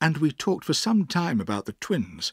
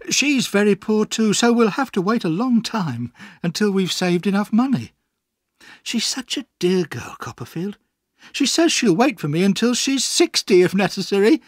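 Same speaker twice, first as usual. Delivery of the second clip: lightly voiced, higher and louder eager speech; lightly acted lovestruck friend; eager young fiancé; 8 semitones higher, 4 dB louder than usual. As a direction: talk up your sweetheart, pitched high. Voices fiancé praising his girl.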